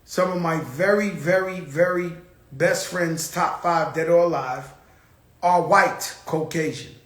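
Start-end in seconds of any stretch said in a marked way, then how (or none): none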